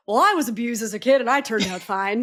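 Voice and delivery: mocking. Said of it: "I'm not trying to do the whole".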